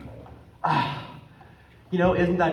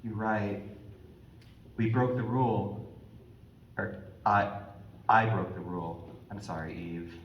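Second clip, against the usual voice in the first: quiet remorse; 10 semitones lower, 11 dB quieter than usual. Acted. Adam admits guilt.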